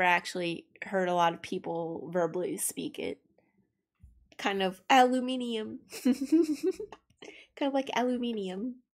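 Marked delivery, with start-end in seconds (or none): 4.4-8.8 mock British aluminium. Shown as a demonstration. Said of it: "It's aluminum".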